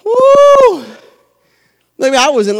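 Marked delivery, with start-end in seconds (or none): 0.1-0.8 high loud celebratory whoop; joyful whoop. Real, not acted. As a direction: let out a happy holler. Preacher celebrating.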